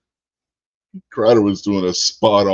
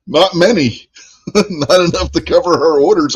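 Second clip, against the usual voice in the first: laughing; 10 semitones higher, 6 dB louder than usual. Real, not acted. Laughing at the mayor's scheme.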